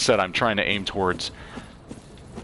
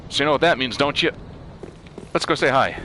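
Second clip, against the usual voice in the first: playful bravado; 7 semitones higher than usual. Real, not acted. Heading toward a scary enemy.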